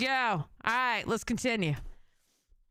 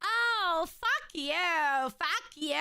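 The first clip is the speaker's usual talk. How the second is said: mock girly gushing; 3 semitones higher than usual. Streamer mocking a caller's reaction to his height, girly gushing. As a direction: imitate a gushing Valley Girl, pitch swooping up. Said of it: "You sounded like a girl".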